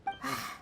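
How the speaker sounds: short, quiet sigh; soft sigh. Acted. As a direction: let out a small breathy sigh. Companion sighs before complaining.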